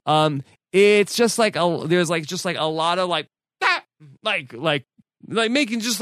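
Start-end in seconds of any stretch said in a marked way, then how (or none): 3.6-3.8 short, sudden mock shout; imitated tic yell. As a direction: blurt out a brief imitated noise. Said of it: "y-, yelling".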